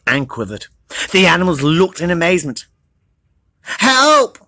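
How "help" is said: squealed. Acted. Trapped nut calling for help, high squeal. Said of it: "squealed the nut".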